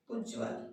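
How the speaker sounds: उज्ज्वल said softly with a doubled ज; quiet careful pronunciation. Shown as a demonstration. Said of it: "ध्यान से एक बार बोल के देखिए उज्ज्वल".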